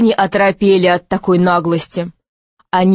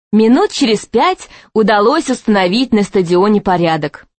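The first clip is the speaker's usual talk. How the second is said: usual speaking voice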